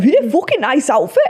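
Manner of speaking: put-on northern English compliment; broad mock northern accent. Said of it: "we do northern accents".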